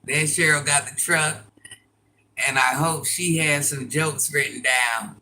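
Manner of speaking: ordinary talk, nothing marked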